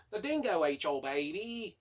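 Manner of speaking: hammed-up Australian accent; mock Australian. Reviewer joking about the book's ending, mock Australian. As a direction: put on a silly Aussie voice for the catchphrase. Jokey famous line in put-on voice.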